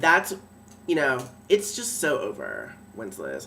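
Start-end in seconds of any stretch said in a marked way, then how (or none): 2.9-3.5 low, quiet, sneering Wenceslas; mocking his name. She mocks the lord by his first name, low and sneering. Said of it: "Fine, Wenceslas, if that's what you prefer".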